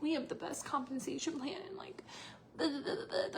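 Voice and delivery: soft, high, whispery mock sales patter; mocking pitch. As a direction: parody a recruiter in a small high voice.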